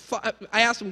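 ordinary delivery

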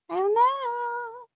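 high, slow drawn-out I don't know. Pretend. Kid puzzling over the game, high and slow. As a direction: stretch it out way up high.

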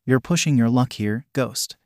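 ordinary delivery, nothing marked

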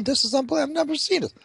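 high, hushed astonishment; amazed disbelief. Acted. The guy at the bar marvels at the Chief, high and hushed. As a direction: marvel under your breath.

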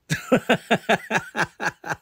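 high rapid staccato laugh. Real, not acted. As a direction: laugh out in a quick, high burst. Laughs at his own joke.

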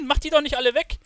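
ordinary talk, nothing marked

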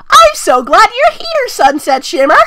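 very high, loud, slow squeal; squeaky excited Twilight. Acted. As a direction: squeal the greeting in falsetto. Twilight greeting in falsetto.

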